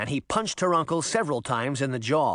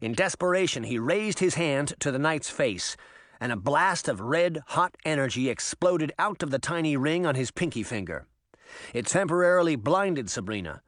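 ordinary delivery, nothing marked

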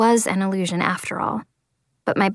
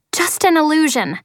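high angry yell; 6 semitones higher than usual. Acted. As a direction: throw the words back at her. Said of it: "I'm yelling now".

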